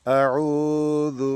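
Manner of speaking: আউযু said very slowly with the উ held about a second; tajweed demo. Shown as a demonstration. Teacher showing students how to recite আউযু, slowly stretched. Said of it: "যু পাতলা করে বলতে হবে".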